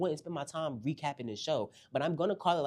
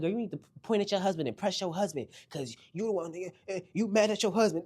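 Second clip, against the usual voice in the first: retold anger; 5 semitones higher than usual. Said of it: "she got mad".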